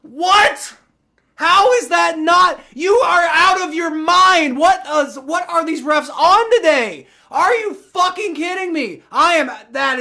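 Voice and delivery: loud, high outraged shouting at the refs; furious yelling. Real, not acted. Streamer yelling at the refs over a missed fumble, furious. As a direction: scream in disbelief at the call. Streamer raging at bad ref call.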